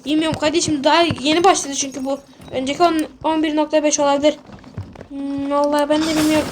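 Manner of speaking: ordinary delivery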